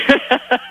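high laugh; a quick real burst of laughter. Real, not acted.

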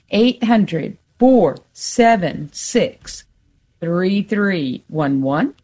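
digits spaced out, very slow; slow phone number. Real, not acted. Radio host giving listeners the pledge number, very slowly. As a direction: read the number slowly with long pauses. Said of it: "saying it so slow".

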